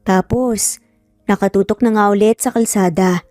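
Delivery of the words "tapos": ordinary delivery, nothing marked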